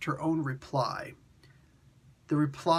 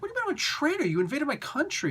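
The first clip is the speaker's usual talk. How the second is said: indignant king; 13 semitones higher, faster than usual. Retold or imitated. Deposed king protests emperor.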